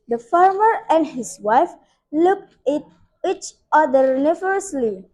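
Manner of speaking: plain reading voice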